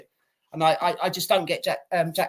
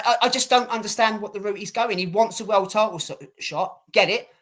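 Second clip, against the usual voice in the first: ordinary delivery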